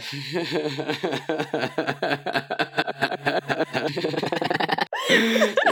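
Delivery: helpless, drawn-out laughter; long laughing fit. Real, not acted. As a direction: keep laughing, unable to stop. Laughs at own remark on hair. (gargalhada longa)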